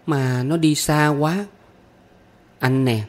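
put-on high, drawn-out child voice; young pupil's high, lingering plaint; pitying little girl. A narrator's reading. Child pities the stork, calls friend.

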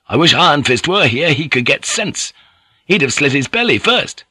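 brash and defiant; hot-headed young samurai. Acted. Narrator voicing young Tomo.

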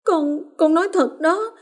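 put-on high child's voice, breaking into a cry; sobbing. A narrator's reading. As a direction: plead through tears, breaking down. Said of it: "trong tiếng nấc". (con Hoa vừa nấc vừa kể với mẹ)

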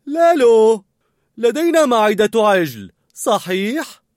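high, loud kitchen call. A narrator's reading. Cook checking an ingredient with a colleague, loud calling. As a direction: call the question across to a colleague. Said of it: "وسألت بصوت عال".

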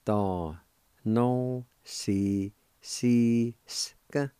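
very slow, every syllable of "Da no si si ska" drawn out. Shown as a demonstration. Teacher sounding out the Cherokee word for lectures, very slowly. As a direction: lay each syllable down slowly for learners. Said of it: "it's pronounced like this".

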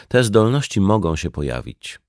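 ordinary talk

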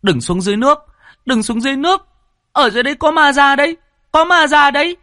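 high, loud panicked warning yell; shouting while running. A narrator's reading. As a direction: scream the warning as you run. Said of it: "vừa chạy vừa hét".

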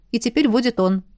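usual speaking voice

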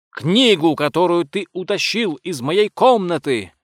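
high, loud indignant outburst; angry accusation. A narrator's reading. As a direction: fling the accusation at the thief. Enraged lady accuses him of theft.

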